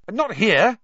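high, loud, urgent warning; hurried. A narrator's reading. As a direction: blurt it before they sit on you. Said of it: "said Harry hurriedly".

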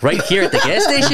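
high, amused disbelief; laughing while asking in disbelief; a laughing why-here question; incredulous laughing. Retold or imitated. Incredulous reply to cantor.

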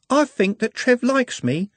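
put-on young girl. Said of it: "Juliet muttered".

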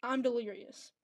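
ordinary talk, nothing marked

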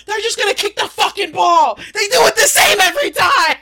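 high, loud exasperated yell; frustrated teen shouting at dad about sports; mad yelling. Retold or imitated. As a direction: rant at dad over the football. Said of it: "I remember I got mad at him".

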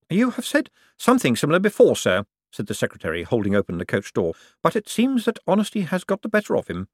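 a hint of the keen, proper clerk; secretary at the coach door, lightly voiced and prim; lightly voiced eager secretary. Drumknot answers Vetinari.

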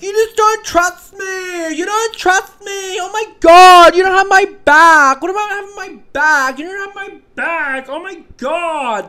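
high, loud, hammed-up wife accusing; high mock shriek of a jilted bride; shrieking girlfriend. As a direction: shriek the betrayal like a reality-show bride. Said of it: "they're always there being like".

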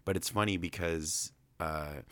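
ordinary delivery

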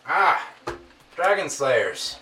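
loud, high, delighted welcome; excited greeting. Acted. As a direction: boom out a delighted hello. Wizard greets party loudly.

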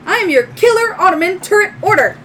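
high, fast, loud robot spiel; little droid. Acted. Droid announcing its function to owner, high fast robot voice. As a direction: rattle off your function as a robot. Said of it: "your little droid".

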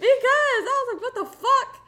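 high, loud and laughing; loud high-pitched incredulous laugh-talk; laughing exclamation. Real, not acted. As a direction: burst out laughing through the words. Laughing retort about being woken.

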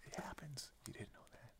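hushed whisper. Pretend. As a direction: drop to a secret whisper. Joking hushed exchange about pregnancy.

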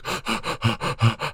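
rapid voiceless panting; mock frantic gasping. Brain searching frantically for things to do, mock gasps. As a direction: pant fast as a comic panicking brain.

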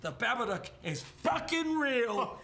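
high, drawn-out alarmed holler; mock conspiracy outburst. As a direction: proclaim it slowly and high, hammed-up.

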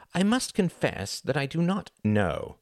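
reply icily, measuring out each word; icy. A narrator's reading. Reginald answering Tom's objection, icily. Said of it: "replied Reginald icily".

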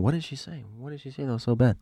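high, hushed bewilderment; baffled inner thought. Retold or imitated. Teller recalls wondering what mom said, baffled and hushed. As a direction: puzzle it over in a high, soft voice.

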